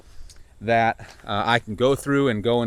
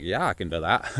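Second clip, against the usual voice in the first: odd low voice; 6 semitones lower, 4 dB quieter than usual. Retold or imitated. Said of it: "Why are we talking like this?".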